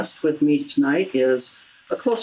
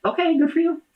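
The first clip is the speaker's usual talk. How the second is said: much higher, sing-song sarcastic "okay, good for you"; mock cheery brush-off. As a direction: wave him off with a mocking, airy lilt. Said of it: "And I'd be like".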